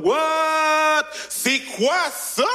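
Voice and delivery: high, drawn-out astonished cry; mock amazement. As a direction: gawk aloud, stretching the words in exaggerated awe. Humans react to the arriving spaceship.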